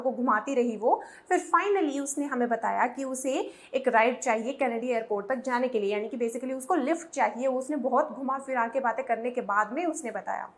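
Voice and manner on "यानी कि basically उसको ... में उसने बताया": normal talking voice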